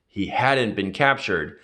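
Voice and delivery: plain reading voice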